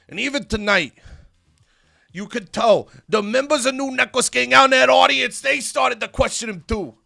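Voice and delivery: worked-up, suspicious challenge with loud, high bursts; wrestler Brooks Jensen's promo voice. Acted. Wrestler accuses Styx in the ring, suspicious and heated. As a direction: call out a schemer in front of a crowd.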